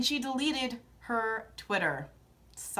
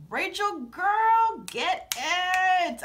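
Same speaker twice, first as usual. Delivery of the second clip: hyped cheer; 6 semitones higher, much slower than usual. Real, not acted. Cheering the clap back.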